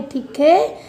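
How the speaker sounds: usual speaking voice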